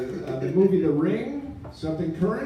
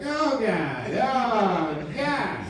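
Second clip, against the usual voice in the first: high, groaning oh God; mock despair; 6 semitones higher, much slower than usual. The ghost caller despairs over no TV, higher mock groan.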